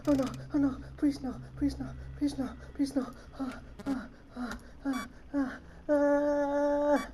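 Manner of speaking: soft, repeated begging; frightened pleading. Real, not acted. Player begging the game for mercy, frightened pleading. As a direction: plead under your breath, dwindling to little gasps.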